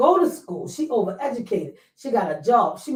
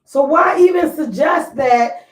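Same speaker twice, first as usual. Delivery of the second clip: heated; 7 dB louder than usual. Real, not acted. Host heatedly arguing the point.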